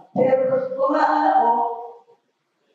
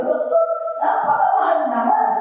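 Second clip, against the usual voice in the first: shocked protest; 9 semitones higher, 3 dB louder than usual. Retold or imitated. Protesting the price to doctor.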